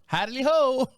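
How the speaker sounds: high chipper howdy ho; Ned Flanders. Retold or imitated. Neighbor greeting like Flanders, high and chipper. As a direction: bounce it out like a cheery neighbor. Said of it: "like a female Ned Flanders".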